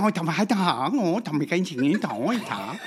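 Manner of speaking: rapid-fire imitation Chinese; mock Chinese. Shown as a demonstration. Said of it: "do the difference between Chinese and Japanese".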